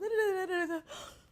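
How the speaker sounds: high, loud tearful gasp; the character hearing love, gasping into tears. Retold or imitated. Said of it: "she goes from".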